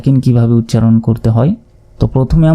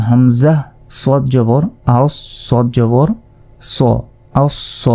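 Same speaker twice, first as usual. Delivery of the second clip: recitation drill; slower than usual. Shown as a demonstration. Drilling doubled letters.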